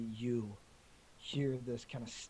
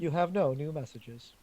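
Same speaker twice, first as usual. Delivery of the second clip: higher, louder robotic announcement; answering machine voice; 4 semitones higher, 8 dB louder than usual. Acted. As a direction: read it like an automated machine.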